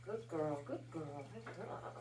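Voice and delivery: soft hushed praise to a dog; quiet praise. Real, not acted. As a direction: praise gently under your breath. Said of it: "had to be pretty quiet upstairs".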